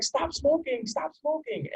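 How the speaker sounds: put-on high tearful child's voice begging; high child voice begging dad to stop; little girl pleading. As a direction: plead like a small crying girl. Three-year-old daughter begs father.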